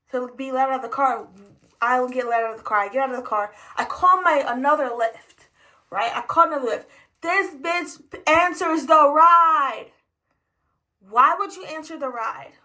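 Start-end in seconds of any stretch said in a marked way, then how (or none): none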